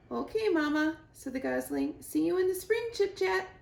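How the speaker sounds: high, loud gosling farewell; gosling says goodbye to the squirrel, high and loud; excited gosling. Acted. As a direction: call goodbye brightly.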